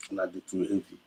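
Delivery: usual speaking voice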